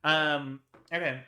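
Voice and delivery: ordinary talk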